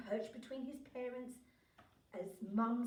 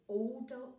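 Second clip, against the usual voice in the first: usual speaking voice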